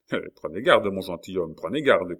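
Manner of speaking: ordinary delivery, nothing marked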